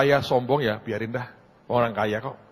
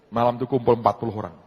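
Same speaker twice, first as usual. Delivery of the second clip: usual speaking voice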